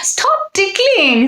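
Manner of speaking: high, slow ticklish wardrobe plea; a wardrobe squirming from tickles; giggly wardrobe. A narrator's reading. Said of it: "said the wardrobe".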